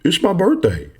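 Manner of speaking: high, sing-song imitation of a naive filer; mock naive answer. Instructor mocking a student's naive answer, high and sing-song. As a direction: mimic someone proudly giving their birthday. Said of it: "You say".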